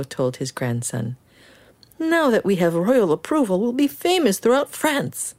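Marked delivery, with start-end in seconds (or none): 2.0-5.3 put-on much higher, overjoyed old man; beaming with delight at the boy; higher, joyful grandpa. Grandpa celebrating royal approval.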